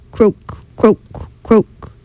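bullfrog croak. A narrator's reading. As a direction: croak like a big frog. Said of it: "bullfrogs by the pond".

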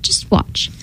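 unvoiced soft whisper. A narrator's reading. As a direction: breathe it as a whisper. Sorcerer whispers before transforming.

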